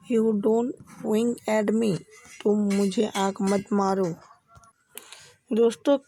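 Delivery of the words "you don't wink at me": very slow, each word spelled out; slow teaching demo. Shown as a demonstration. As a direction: say it slowly for learners to copy. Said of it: "तो आप बोल सकते हैं". (धीमे-धीमे, साफ़ उच्चारण में)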